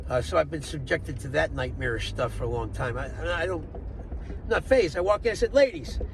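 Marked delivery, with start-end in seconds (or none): 5.5-6.0 mock grand announcement. Calling out to room of drag queens.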